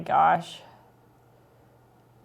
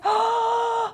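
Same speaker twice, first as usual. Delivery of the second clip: sharp gasp. Real, not acted. Reacting to leaderboard change.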